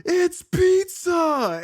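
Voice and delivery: put-on slowly drawn out like a wide-eyed kid; a hammed-up awestruck reveal; Michelangelo. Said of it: "He goes".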